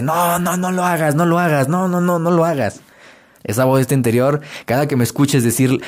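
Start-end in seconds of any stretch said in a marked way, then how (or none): 0.0-2.8 lightly voiced bitter witch, a bit higher. Inner witch warning the listener off, lightly witchy and sour. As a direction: nag like a sour old witch, only a hint of it. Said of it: "una bruja amargada que te dice".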